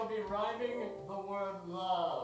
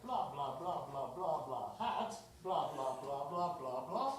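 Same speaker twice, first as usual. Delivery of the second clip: low rhythmic blah blah chant; low sing-song blah demo; 7 semitones lower than usual. Shown as a demonstration. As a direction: chant the filler in a low beat. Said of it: "rhyme the final word of their sentences".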